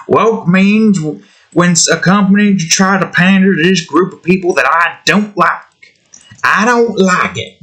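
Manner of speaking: high-pitched hammed-up Southern accent. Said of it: "they would probably do a redneck accent".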